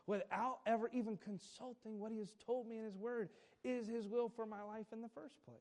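ordinary talk

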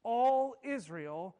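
slow, loud stress on All; All Israel proclaimed; emphatic. Retold or imitated. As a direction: lean hard on All and draw it out. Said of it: "he emphasizes and said".

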